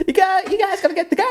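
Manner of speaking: very high, fast squealing voice; hammed-up high-pitched urging; high comic impression. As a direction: rattle it off in a piercing squeak. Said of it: "borderline Kevin Hart".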